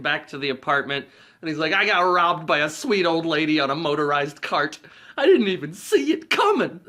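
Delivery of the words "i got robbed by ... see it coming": higher and louder, laughing through the words; laughing quote. Retold or imitated. Harry telling Lloyd of the robbery, laughing. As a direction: crack up while whining about the robbery.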